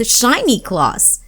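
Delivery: ordinary delivery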